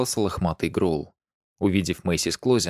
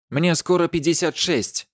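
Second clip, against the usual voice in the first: raging; 9 semitones higher than usual. A narrator's reading. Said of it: "бушевала королева".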